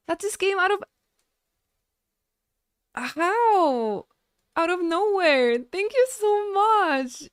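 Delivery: loud, high, overwhelmed thanks; real shock. Real, not acted. Streamer thanking viewer for a gift out of nowhere, shocked. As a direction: exclaim it, stunned and overwhelmed. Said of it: "deep shock at the moment".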